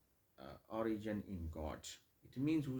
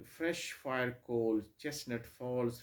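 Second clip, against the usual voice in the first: "fresh fire coals, chestnut falls" read very slowly and loud, every word weighted; slow stressed reading; 3 semitones higher, 8 dB louder, much slower than usual. Shown as a demonstration. Teacher reading the second line to show consecutive stresses, slow and heavy. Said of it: "The second line".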